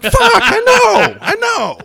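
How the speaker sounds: squeaky, slowed, strangled mock panic; a hammed-up high wail of embarrassment. Host mimicking himself panicking after botching a player's name, high and stretched. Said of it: "And I'm like".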